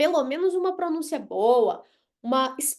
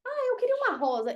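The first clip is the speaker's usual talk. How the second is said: quoting himself much higher and fast; mock self; 9 semitones higher, faster than usual. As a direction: play your own eager request. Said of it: "falando com o meu amigo gringo".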